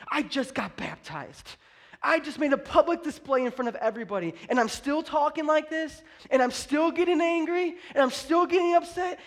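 indignant venting in someone else's shoes; exasperated complaint. Acted. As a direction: build a mock grievance, pitch climbing. Voices discouraged new believer complaining.

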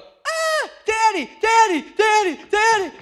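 put-on high squealing child calling Daddy; excited child shrieking for her dad; kids squealing. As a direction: squeal it like a thrilled little girl. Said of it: "And they go".

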